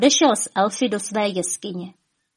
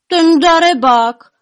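high, loud, drawn-out complaint; lamenting; 9 semitones higher, 9 dB louder, slower than usual. A narrator's reading. Horrified elves hearing the fox's news, lamenting. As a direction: wail it slowly in a raised voice.

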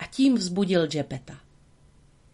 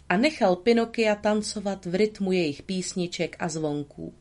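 ordinary delivery, nothing marked

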